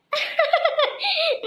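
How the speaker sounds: high burst of laughter. Acted. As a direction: laugh out at the joke. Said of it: "मुझे तो हंसी आ रही है". (ऊँची खिलखिलाती हँसी)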